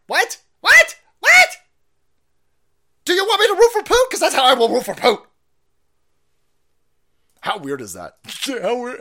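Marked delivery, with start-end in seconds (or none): 0.1-5.2 very high, loud hammed-up squawks; mock incredulous yelling. Host threatening to root for Putin, mock shrieking disbelief. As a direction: burst out louder and higher, absurdly indignant.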